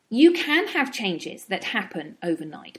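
ordinary talk

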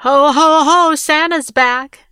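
loud, high, slow Santa call; jolly shout. A narrator's reading. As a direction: boom it in like Santa at the door. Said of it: "shouted Rick".

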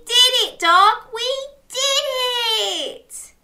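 high, triumphant cheering; jubilant cheer. Acted. Crab celebrates with Dog, cheering. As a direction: celebrate each phrase, pitched right up. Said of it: "cheered Crab".

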